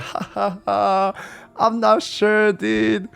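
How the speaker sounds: high voice with a fake laugh; awkward brush-off. Imitates man's awkward brush-off.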